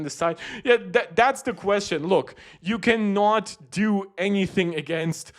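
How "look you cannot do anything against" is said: ordinary delivery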